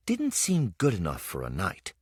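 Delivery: ordinary delivery, nothing marked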